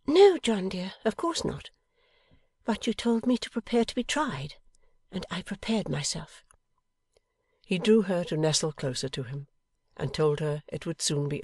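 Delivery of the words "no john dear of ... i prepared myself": high wifely voice, sincere and firm; high-pitched loyal wife; devoted wife, earnest. A narrator's reading. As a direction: pledge your faith in a high, earnest voice.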